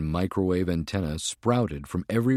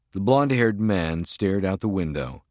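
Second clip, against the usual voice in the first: usual speaking voice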